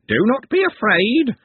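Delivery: high, slow squawk; squawking bird creature. A narrator's reading. Bird creature reassuring frightened people, squawking. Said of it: "squawked one of them".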